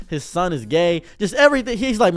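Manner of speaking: ordinary delivery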